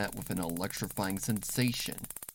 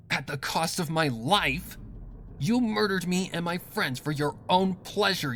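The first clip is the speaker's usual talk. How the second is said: louder and much higher, building to a breaking scream; furious tearful shout; 12 semitones higher, 6 dB louder than usual. Acted. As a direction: pour out the betrayal, voice cracking at the end. Scootaloo confronts her killer sister.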